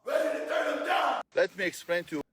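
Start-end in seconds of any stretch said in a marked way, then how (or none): 0.0-1.2 high cartoon character voice; squeaky toon delivery; vintage cartoon voice. Real, not acted. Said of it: "Why is he talking like a cartoon character?".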